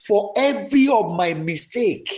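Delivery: passionate repentance prayer that swells into shouts; fervent prayer. Shown as a demonstration. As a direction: cry out to God for pardon, building and falling. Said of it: "Go ahead and pray".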